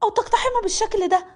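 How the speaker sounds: put-on high, protesting young woman's voice; upset young maid. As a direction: confront him, shocked and hurt. Narrator voices maid Malak. (صوت خادمة شابة مصدومة ومحتجة)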